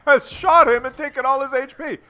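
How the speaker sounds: high, loud outraged disbelief; shocked by the teammate's betrayal; incredulous shout. Real, not acted. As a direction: yell it like you can't believe it. Teammate shoots him, outrage.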